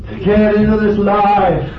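high, loud, ringing challenge to the congregation; impassioned preaching. Real, not acted. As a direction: preach it at full force, pitched right up. Preaches at full force.

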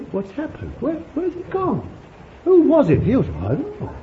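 high-pitched, puzzled onlookers; mock bewilderment. Acting out bewildered healed man and onlookers.

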